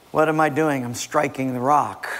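normal talking voice

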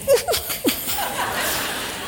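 high, sneaky giggle in character; gleeful laugh. Acted. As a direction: giggle like you're getting away with it. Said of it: "then there's Portuguese laughing".

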